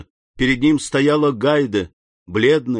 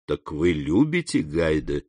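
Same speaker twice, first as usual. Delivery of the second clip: count, hiding emotion; 7 semitones lower, 4 dB quieter than usual. A narrator's reading. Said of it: "с волнением, которое он тщетно пытался скрыть".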